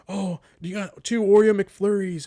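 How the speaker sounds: high, loud call across the kitchen; yelling staff. Retold or imitated. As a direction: shout it to the back like a flustered worker. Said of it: "they're yelling".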